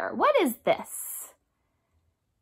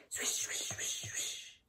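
soft breathy wiper swishes; whispered swish; 20 dB quieter than usual. Shown as a demonstration. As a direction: hush it like windshield wipers. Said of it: "Can you make that noise with me?".

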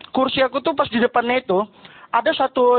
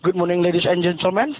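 mumbled pilot English; 7 semitones lower than usual. Retold or imitated. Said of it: "Dia terdengar awalan sama akhirnya aja".

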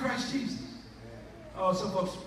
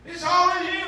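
loud, high proclaim; loud fervent cry; shouted declaration; 11 semitones higher, 11 dB louder than usual. Acted. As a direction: belt it to the back row. Preacher climaxing the point.

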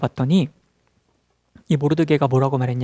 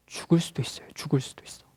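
much softer, grave aside to the listeners; hushed warning; 9 dB quieter than usual. Real, not acted. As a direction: drop to a near whisper. Hushed warning of deadly consequence. (속삭이듯 낮춘 경고)

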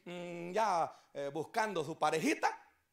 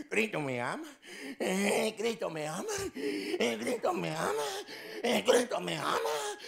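woman repeating; 5 semitones higher, 4 dB louder, slower than usual. Acted. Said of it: "Y se fue".

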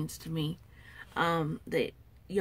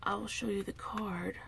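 whisper; 8 dB quieter than usual. Real, not acted. Soft aside.

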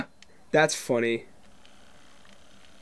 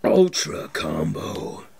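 deep slow announcer call; fighting game announcer; 10 semitones lower, much slower than usual. Pretend. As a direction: boom it out like an arena announcer. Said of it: "sounded more like an arena announcer".

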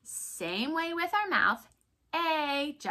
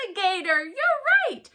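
high and loud "You're right"; praising cheer; 8 semitones higher, 8 dB louder, faster than usual. Acted. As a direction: cheer the child's answer. Teacher praising pretend answer.

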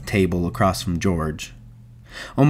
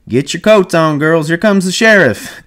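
put-on high, raised call to the girls; yelling over shoulder; 9 semitones higher, 4 dB louder than usual. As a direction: call it out like a madam warning her girls. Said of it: "she yells over her shoulder".

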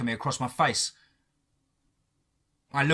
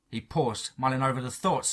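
ordinary delivery, nothing marked

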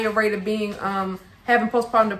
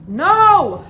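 loud, high warning no; sharp no; 8 semitones higher, 8 dB louder than usual. Real, not acted. Mother stopping her toddler with a loud, high no.

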